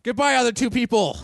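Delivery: high, bright eager voice of a cheerful traveller; cheerful Canton. Acted.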